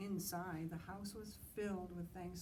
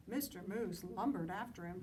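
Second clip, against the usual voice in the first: ordinary delivery, nothing marked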